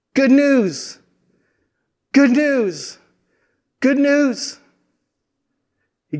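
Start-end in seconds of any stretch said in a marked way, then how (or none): 0.1-4.6 high, ringing herald's call; joyful cry of good news; shouting good news. Acted. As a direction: call it out like a runner arriving. Runner shouting news from afar.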